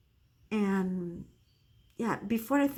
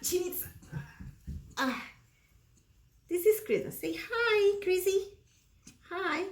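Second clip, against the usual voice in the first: much higher sing-song voice for a pet; 8 semitones higher than usual. Real, not acted. Introducing her pet Christmas, high baby talk.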